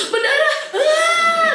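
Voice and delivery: tangisan anak, tinggi dan keras; anak menangis. Retold or imitated. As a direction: tirukan anak yang menangis lihat darah. (menirukan anak menangis keras)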